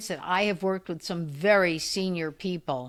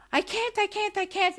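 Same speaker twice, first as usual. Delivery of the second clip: high wailing mimic of a frightened old woman; a hammed-up hysterical patient's high cry; imitating hysterical crying; 11 semitones higher than usual. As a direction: mimic her panicked wail, pitched high. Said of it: "she's crying and screaming".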